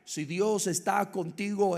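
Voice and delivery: ordinary talk, nothing marked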